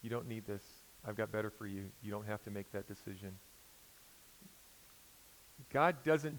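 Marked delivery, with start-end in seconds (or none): 0.0-3.4 much softer, gentle voice of God; soft inner voice. Retold or imitated. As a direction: say it very softly, like a voice in the back of your mind. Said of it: "you hear his voice saying".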